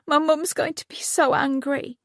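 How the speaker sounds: high, wobbling voice near tears. A narrator's reading. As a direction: hold back tears, dreading mum. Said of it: "bottom lip began to quiver".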